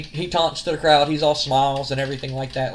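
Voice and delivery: normal talking voice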